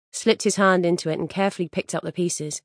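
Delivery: plain reading voice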